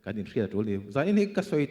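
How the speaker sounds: ordinary talk, nothing marked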